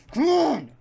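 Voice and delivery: sudden high, loud yell of frustration; frustrated shout. Real, not acted. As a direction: yell it at the stuck rover. Rover stuck hitting rocks.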